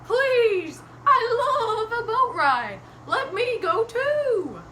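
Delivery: high, loud, slow whinnying voice; pleading horse. Acted. Horse asks Mister Gumpy for a ride, loud pleading. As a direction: beg loudly with long drawn-out words. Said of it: "Horse said".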